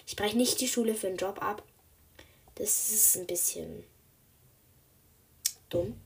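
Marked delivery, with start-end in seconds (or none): 2.6-6.0 whispering. Real, not acted. Whispered aside about being dumb.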